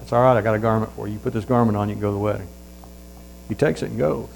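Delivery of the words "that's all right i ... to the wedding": softer, lower reassuring voice; a soft kind host offering a beggar a garment; gentle offer. Acted. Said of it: "He said".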